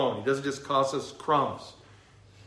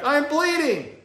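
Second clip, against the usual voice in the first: put-on high, loud hurt child's wail; daughter calling again; 13 semitones higher, 6 dB louder, slower than usual. Daughter calling her mom again from off screen, high and loud. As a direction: wail it like a little girl. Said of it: "the voice comes back".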